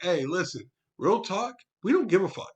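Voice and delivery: blunt confrontation. Retold or imitated. Crew member standing up to the assistant, blunt and heated.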